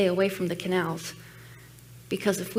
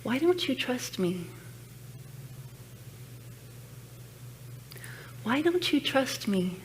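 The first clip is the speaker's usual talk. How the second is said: a lightly voiced God, higher and a touch softer; God's gentle voice; 4 semitones higher, 6 dB quieter than usual. God asking the pastor why she won't trust. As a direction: ask it tenderly, as God would. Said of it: "And God said".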